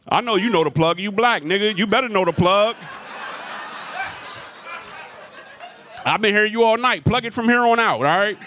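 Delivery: high, teasing call-out. Real, not acted. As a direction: needle the guy in the crowd. Teasing audience member.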